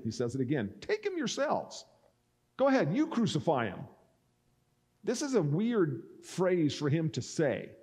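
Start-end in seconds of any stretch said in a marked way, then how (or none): 0.8-3.8 high-pitched, goading challenge; mocking taunt. Pilate to the crowd demanding crucifixion, mocking high-pitched taunt. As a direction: throw it back at them, pitched way up. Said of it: "he's mocking them".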